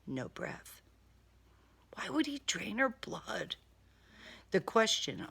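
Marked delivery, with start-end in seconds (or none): none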